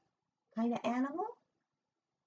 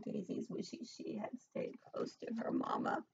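whisper; 2 semitones higher, 10 dB quieter than usual. A narrator's reading. Scary moment.

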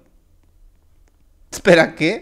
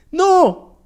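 short, high "no" yelp; mock cry of dismay; 8 semitones higher, 4 dB louder than usual. Escaping player is caught, yelps in mock dismay.

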